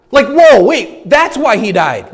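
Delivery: awestruck, it finally clicks; amazed discovery; sudden realization. Retold or imitated.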